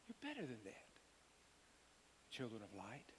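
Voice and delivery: soft, tender reminder; gentle. Shown as a demonstration. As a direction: reassure them quietly. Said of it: "I want to get the tone right".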